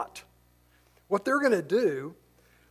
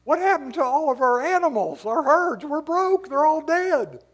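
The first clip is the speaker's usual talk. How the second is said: much higher and louder, dismayed villagers; upset townsfolk; 9 semitones higher, 5 dB louder than usual. Acted. Townspeople discovering their dead pigs, loud and much higher. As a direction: cry out like farmers who lost their herd. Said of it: "the people who live there are gonna go".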